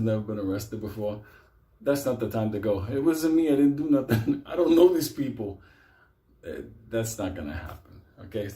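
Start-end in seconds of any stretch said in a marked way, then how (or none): 2.9-5.6 high, loud protest of a guy caught in a raid; panicky denial. Retold or imitated. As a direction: plead your innocence to the cops. Mock arrestee protesting innocence.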